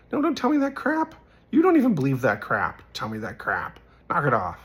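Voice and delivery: retold outburst, starting high and loud; indignant scolding. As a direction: tell a friend off, re-enacted with force. Retold quarrel with his friend.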